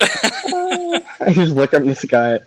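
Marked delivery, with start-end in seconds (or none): 0.0-1.0 mock blissful sigh. Mimics gazing up at pineapple.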